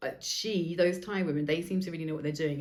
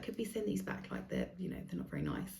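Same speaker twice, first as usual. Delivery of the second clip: soft polite request; 14 semitones lower, 9 dB quieter than usual. Retold or imitated. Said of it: "She was like".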